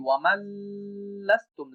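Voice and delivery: ওয়ামাল্লাস্তুম with the লাম held too long; lingering on lam. Shown as a demonstration. Teacher demonstrates the wrong way with a held lam. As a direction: drag out the doubled L in ওয়ামাল্লাস্তুম. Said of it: "লামে দেরি করি".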